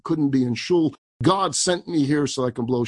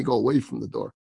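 ordinary delivery

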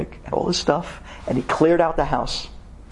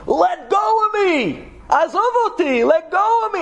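anguished yell; 13 semitones higher than usual. Acted. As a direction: cry out as if something is gripping you. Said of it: "he's yelling and screaming".

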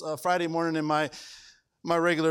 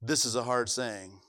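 quoted complaint; 8 semitones lower, 4 dB quieter than usual. Retold or imitated. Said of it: "Who said".

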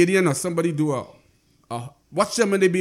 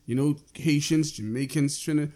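normal talking voice

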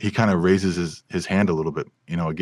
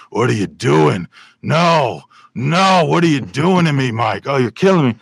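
loud, high, strained gravel-voiced lament; anguished Pacino-style outburst; Al Pacino impression; 6 semitones higher, 6 dB louder than usual. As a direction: wail it like a tortured movie star. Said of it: "mumbling back there".

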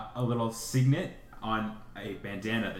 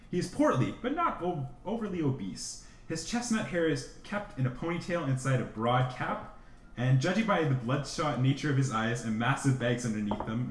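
usual speaking voice

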